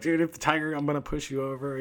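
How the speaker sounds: half-joking betrayal; joking threat. Retold or imitated. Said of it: "and I'm like".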